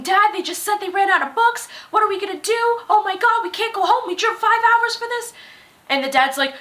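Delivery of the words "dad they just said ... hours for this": frantic and panicking. Retold or imitated. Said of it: "all frantic and panicking".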